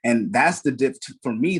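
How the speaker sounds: ordinary talk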